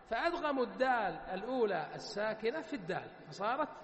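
ordinary delivery

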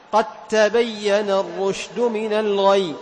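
slow chanted verse; Quran recitation. Shown as a demonstration. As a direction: recite it with measured tajweed. (تلاوة متأنية)

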